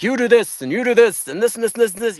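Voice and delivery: high, rattled-off orders; mock a pushy list of demands; hammed-up rapid-fire instructions; brusque bossing. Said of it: "be like".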